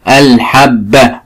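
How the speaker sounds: "الحبة" drawn out slowly, holding the doubled b. Shown as a demonstration. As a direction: sound out "الحبة" as "al-hab-ba". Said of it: "وفيها حرف مشدد اللي هو الباء". (نطق "الحبة" ببطء مع تشديد الباء)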